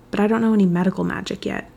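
ordinary delivery

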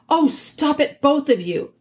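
exasperated king. A narrator's reading. King breaks up a quarrel.

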